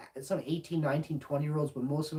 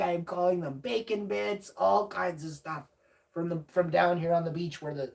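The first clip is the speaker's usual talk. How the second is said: normal talking voice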